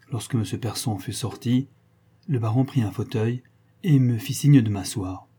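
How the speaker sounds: usual speaking voice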